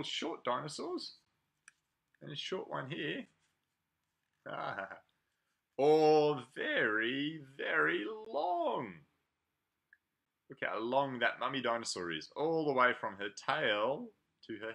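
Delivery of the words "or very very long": stretched out. Acted. Drawing out long.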